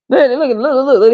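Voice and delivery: ordinary talk, nothing marked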